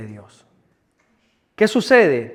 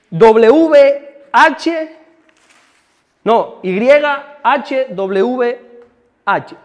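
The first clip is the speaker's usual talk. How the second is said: letters spelled out slowly and loud; slow careful spelling of the letters; deletreo lento; 6 semitones higher, 9 dB louder, much slower than usual. Shown as a demonstration. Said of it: "tomen nota". (deletreando despacio)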